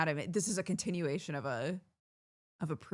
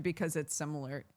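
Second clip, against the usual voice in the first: ordinary delivery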